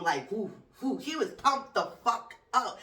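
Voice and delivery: usual speaking voice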